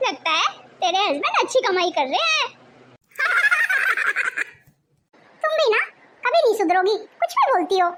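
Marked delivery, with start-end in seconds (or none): 3.2-4.4 high, rippling laugh; social laugh. Acted. Hostess laughs at her friend's compliment, amused. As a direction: laugh along at a flattering tease.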